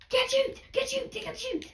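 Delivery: frantic high yelps; panicked shouting while under attack; panicking gamer. Real, not acted.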